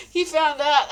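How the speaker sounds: words squeezed out through laughter; laughing. Real, not acted. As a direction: laugh while saying it. Laughing fit after story.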